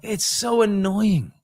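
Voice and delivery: high, slow drawn-out complaint; a hammed-up Trump grumble. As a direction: stretch it out in the impression. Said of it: "And I'm like".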